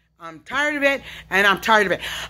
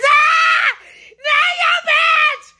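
screaming outburst; much slower than usual. Real, not acted. Cursing the witches inside her, slow screaming. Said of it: "This yelling and screaming".

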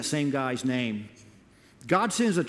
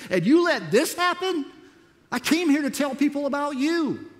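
raised, exasperated complaint to God; pouring out frustration, pitch climbing higher; ticked off; 6 semitones higher than usual. Retold or imitated. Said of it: "I was ticked off".

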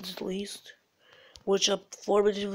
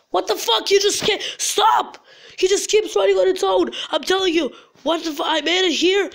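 higher, louder panicked protest; agitated, raised-voice disbelief; alarmed outburst; 7 semitones higher, 3 dB louder than usual. Real, not acted. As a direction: yell at the runaway game. Game moves on its own.

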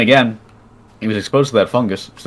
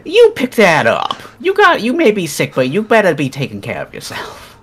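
scolding parent; 10 semitones higher, 5 dB louder than usual. Retold or imitated. Speaker scolding the pet in a nagging mom voice. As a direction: scold him like a worried mother. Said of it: "and I was like".

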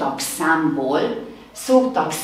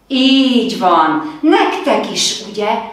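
enthusiastic praise; 4 semitones higher, 5 dB louder than usual. Acted. Teacher praising children.

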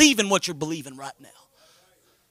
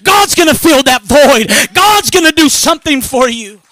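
loud, raised-pitch preacher's cry; full-force preaching; 6 semitones higher, 14 dB louder than usual. Real, not acted. Preacher promising the church God will fill their empty pockets, full-force preaching. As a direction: preach it hard, driving each phrase home.

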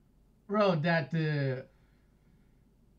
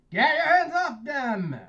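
shouting; 7 semitones higher, 6 dB louder than usual. Pretend. Player voicing game character shouting.

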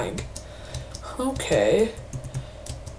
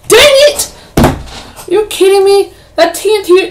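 loud, high frustrated yell; real rage at getting blown up; furious outburst; 11 semitones higher, 9 dB louder than usual. Real, not acted. Gamer killed by TNT, yelling in real frustration.

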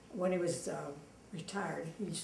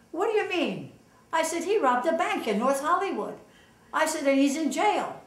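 much higher and louder, breathless news; excited retelling; 7 semitones higher, 10 dB louder than usual. Retold or imitated. Wife telling husband Frank the doctor robbed a bank, excited and loud. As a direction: blurt out the shocking news.

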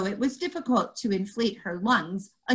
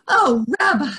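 relieved; 5 semitones higher, 7 dB louder than usual. Acted. Relieved exclamation.

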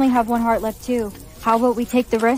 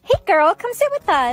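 flirty call; 9 semitones higher, 3 dB louder than usual. Acted.

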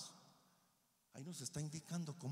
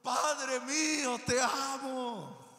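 loud, high, very slow cry of love; 12 semitones higher, 11 dB louder, much slower than usual. Acted. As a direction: call out to God tenderly at full voice. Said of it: "Ese es ese clamor".

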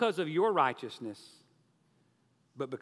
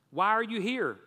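higher, louder question; questioning employer; 7 semitones higher, 6 dB louder than usual. A narrator's reading. Rich business owner to the debtor, raised questioning voice. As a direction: put the question pointedly as the boss. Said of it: "the rich business owner asked".

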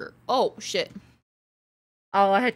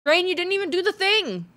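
higher, louder call-out; 9 semitones higher, 4 dB louder than usual. Real, not acted. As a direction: exclaim at the viewer. Calling out to chat user.

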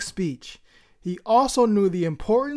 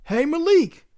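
higher, louder call across the room; yelling; 7 semitones higher, 4 dB louder than usual. Acted. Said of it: "yelled across the cafeteria".

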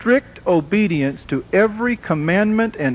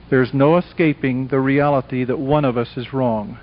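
normal talking voice

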